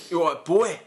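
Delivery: hammed-up bruiser greeting as a challenge; Lenny McLean. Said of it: "do you reckon he talked like this".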